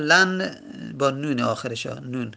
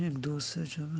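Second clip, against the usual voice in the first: much softer, absent counting to oneself; counting under breath; 13 dB quieter, faster than usual. Real, not acted. Teacher checking which letter it is, counting under breath. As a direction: count quietly to yourself.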